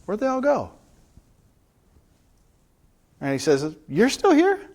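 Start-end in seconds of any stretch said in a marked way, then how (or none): 0.0-0.7 high, puzzled look-around question; Jesus glancing up, surprised the crowd has vanished. Acted. Jesus looking up at the empty square, surprised. 3.9-4.6 much higher, louder startled question; Jesus surprised the woman hasn't left, high and loud. Acted. Jesus finds the woman still there.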